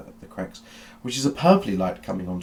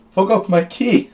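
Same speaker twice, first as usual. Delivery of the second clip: high, loud, slow cry; excited eccentric doctor; 11 semitones higher, 10 dB louder than usual. Acted. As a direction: call back gleefully. GM voices Doctor Beige.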